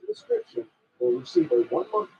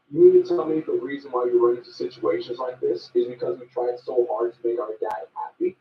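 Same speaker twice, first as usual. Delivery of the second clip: ordinary delivery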